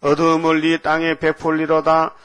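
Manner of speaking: slow, loud monotone recitation; scripture reading. Acted. Preacher reading the verse to the congregation, loud measured intoning. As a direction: intone the verse slowly and forcefully on one note. Reads the verse's closing words.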